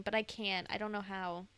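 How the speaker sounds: ordinary delivery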